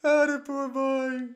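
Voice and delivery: loud, high, slow teasing sympathy; mock pity. Real, not acted. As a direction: pretend to feel sorry. Mock pity while celebrating.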